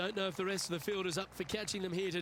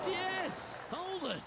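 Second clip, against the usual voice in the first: excited shout; 13 semitones higher, much slower than usual. Real, not acted. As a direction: yell at the ball to stop. Cheering ball toward hole.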